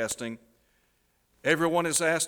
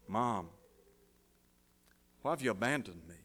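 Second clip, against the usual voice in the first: soft, sorrowful plea; forsaken child, softly voiced; 4 semitones lower, 7 dB quieter than usual. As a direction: ask it quietly, as a son left behind. Abandoned son calls to parents.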